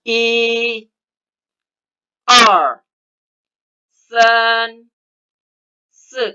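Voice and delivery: each Chinese number called out with its tone; Mandarin count. Shown as a demonstration. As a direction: lead the class through the count. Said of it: "kita mulai dari angka pertama menggunakan isyarat tangan".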